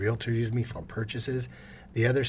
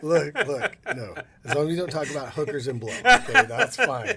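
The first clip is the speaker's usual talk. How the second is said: fast, higher, breaking into laughter; laughing joke; 7 semitones higher, 6 dB louder than usual. Real, not acted. Guest joking to the host, laughing. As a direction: rattle it off then laugh. Joking about hookers and blow.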